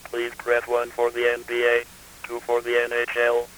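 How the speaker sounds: robotic menu read on one pitch; monotone. Shown as a demonstration. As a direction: list the options with no inflection.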